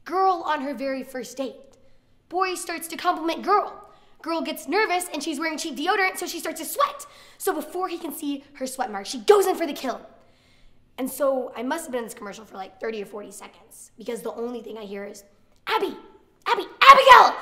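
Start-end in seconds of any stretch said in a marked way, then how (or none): 0.0-10.0 higher, emphatic ad announcer, getting louder toward the punchline; commercial voice-over. Retold or imitated. Deodorant commercial playing out in her head, hyped announcer voice. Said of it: "commercial starts to play out in my head". 15.7-17.4 much louder, higher shout on the full name; calling out sharply. Retold or imitated. Snapping a daydreamer back to attention, escalating shout.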